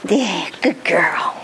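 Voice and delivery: slow, hushed whisper of praise. Shown as a demonstration. Quiet praise after celebration.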